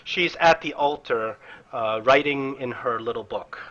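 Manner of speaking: ordinary talk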